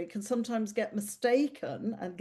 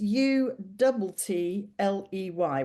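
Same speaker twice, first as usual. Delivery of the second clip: spelled out slowly; slower than usual. Real, not acted. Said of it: "[name]".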